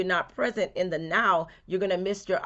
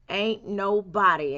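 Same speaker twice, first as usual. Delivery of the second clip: "Ain't nobody" slow and louder, leaning on it; emphatic ain't; 6 dB louder, slower than usual. Real, not acted. To the viewer, insisting nobody can stop them, slow loud emphasis. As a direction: hit "Ain't nobody" slow with full weight. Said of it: "yes, I said ain't".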